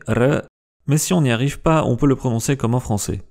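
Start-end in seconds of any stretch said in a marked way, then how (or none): 0.1-0.4 "R" trilled with the tongue tip; a short trilled "R"; rolled R. Shown as a demonstration. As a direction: roll the "R". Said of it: "doit être de préférence roulé".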